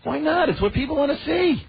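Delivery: high-pitched, exasperated objection; indignant protest. Real, not acted. Protests co-host's objection about TV.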